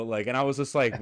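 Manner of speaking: ordinary talk, nothing marked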